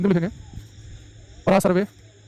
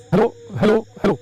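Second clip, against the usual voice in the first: hello at changing volume. Shown as a demonstration. Teacher varying hello volume to show loudness. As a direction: raise the volume across the hellos. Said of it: "उसकी loudness बदल रही है".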